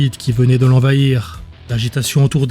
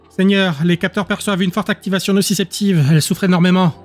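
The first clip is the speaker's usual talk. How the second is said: raised-pitch, concerned report; worried scientist; 5 semitones higher than usual. Acted. Scientist warns his lord the subject suffers, worried. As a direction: alert your lord with concern in a higher voice.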